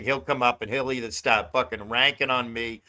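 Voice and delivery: ordinary talk, nothing marked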